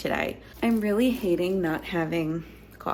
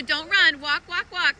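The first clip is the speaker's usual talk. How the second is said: calling out; 8 semitones higher, 8 dB louder than usual. Real, not acted. Mom calling after her kids running to the bus, loud and high. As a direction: send them off with a high call.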